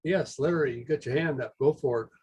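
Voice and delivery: ordinary talk, nothing marked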